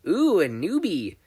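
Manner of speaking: slow, high, loud delighted coo. Acted. Mei thrilled to meet a newbie, slow and delighted. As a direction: savor the discovery.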